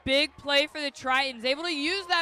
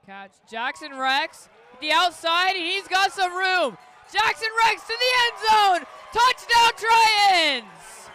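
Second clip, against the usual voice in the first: yelling the touchdown, rising to a roar; touchdown call; 7 semitones higher, 10 dB louder than usual. Real, not acted. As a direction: build to a full shout. Touchdown call in live commentary.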